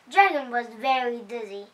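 ordinary delivery

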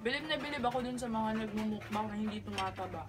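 talking while chewing; slightly muffled chewy speech; mouth full of food. Real, not acted. Seen 0: Food goes in at -3; lips and cheeks work through the speech.

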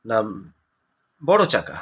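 ordinary talk, nothing marked

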